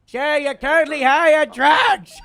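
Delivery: shouted high and loud, exasperated; shouting. Acted. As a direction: yell it at the stoned guy. Cop yells at drugged man.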